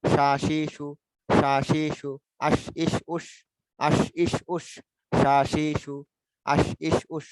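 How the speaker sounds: সা, শি, সু with "sh" and long vowels; palatal sh drill. Shown as a demonstration. Teacher drilling shin for students, palatal sh. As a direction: chant আশ, ইস, উস ending on a strong sh. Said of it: "তালব্য স্বর মতো উচ্চারণ হয়".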